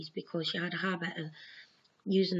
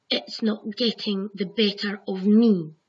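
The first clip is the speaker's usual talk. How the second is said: louder, slow, defiant declaration; 8 dB louder, slower than usual. Retold or imitated. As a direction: stand up to it, loud and steady. Defying the dream, taking control.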